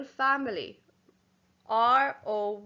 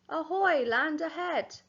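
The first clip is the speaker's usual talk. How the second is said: a lighter second pirate hail; pirate call; 5 semitones higher than usual. Acted. Teacher reading the pirate's cry to the class. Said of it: "the pirate is shouting".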